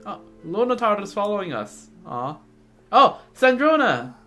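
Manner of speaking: ordinary talk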